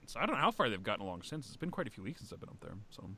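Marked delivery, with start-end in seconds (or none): none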